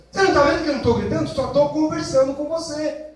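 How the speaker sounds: rushed, high, loud yell; fast shout. Acted. Parent yells at child that he isn't shouting, fast and high.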